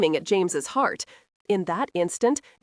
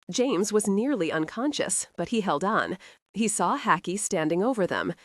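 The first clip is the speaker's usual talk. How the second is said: usual speaking voice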